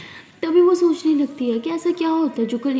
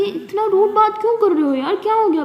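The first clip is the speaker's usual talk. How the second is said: hurt protest; 7 semitones higher, 5 dB louder than usual. Acted. Friend upset at rude reply.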